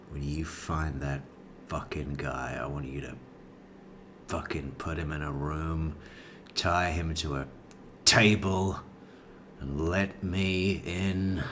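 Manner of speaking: low, quiet, measured menace; draconian threat. Acted. What he should have said about the killer, low draconian menace. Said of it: "draconian response of".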